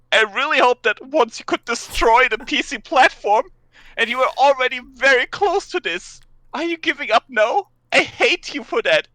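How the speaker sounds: mock outraged rant. Reading angry forum post aloud.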